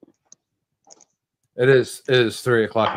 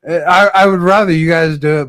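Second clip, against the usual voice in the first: loud insistence; 7 semitones higher, 9 dB louder than usual. Real, not acted. Raised voice in argument.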